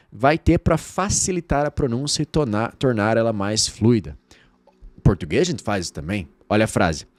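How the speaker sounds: ordinary delivery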